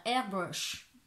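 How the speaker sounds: French speaker's attempt at an English accent; "airbrush" in showy would-be English; mock English accent. Shown as a demonstration. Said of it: "Avec mon superbe accent anglais".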